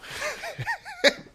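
high cackle at his own joke; corny dad laugh. Real, not acted. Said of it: "I'm laughing at my own jokes".

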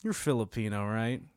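softly imitated stranger's question; tentative mock guess, kept low; low, quiet guess. Imitating strangers guessing his background.

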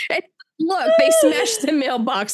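words squeezed out through real laughter, pitch jumping up; laughing. Real, not acted. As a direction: laugh your way through the line. Laughing retelling the golems' walk.

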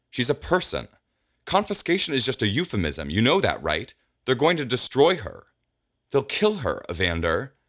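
high, pleading voice; desperate pleading. Acted. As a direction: beg him to understand her panic. Alondra pleads to save robot.

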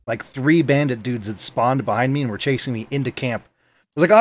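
ordinary delivery

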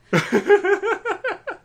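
high burst of laughter; loud laugh. Real, not acted. Laughs at cheese breath.

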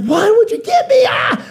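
high, loud, crying wife voice; shrieking wife. Retold or imitated. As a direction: shriek it out in dismay. Wife shrieks at treadmill gift.

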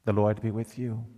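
usual speaking voice

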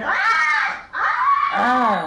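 loud imitated woman's scream; woman scream imitation. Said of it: "sounded like a woman screaming".

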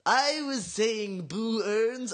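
high, very drawn-out quote; imitated cartoon line. Quoting a best line from The Simpsons, slow high imitation.

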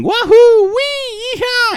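put-on very high, loud drunken whoops; high yeehaw howl of a reveler; drunken whooping. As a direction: whoop like a drunk running off naked. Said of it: "while he was all drunk and going".

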